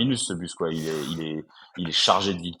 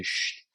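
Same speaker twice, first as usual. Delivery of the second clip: long imitated "shh"; hushing shh. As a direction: hush like a whole crowd. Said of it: "tout le monde fait". (chut collectif imité)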